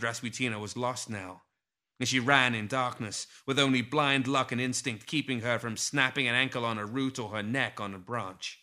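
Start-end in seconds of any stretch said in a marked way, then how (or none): none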